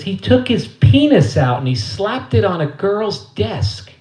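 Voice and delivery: high, loud, drawn-out mother voice; hammed-up motherly outrage; scandalized mother. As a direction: spell out the shocking news slowly as Mom. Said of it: "She says".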